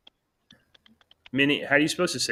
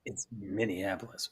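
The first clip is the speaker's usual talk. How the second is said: mock whispered correction; 5 semitones lower, 12 dB quieter than usual. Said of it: "echoing the stranger's line".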